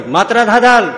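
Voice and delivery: loud, high call of the name. Acted. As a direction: shout the servant awake from across the room. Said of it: "બૂમ પાડી".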